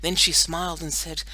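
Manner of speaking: ordinary delivery, nothing marked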